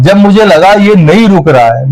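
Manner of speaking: ordinary talk, nothing marked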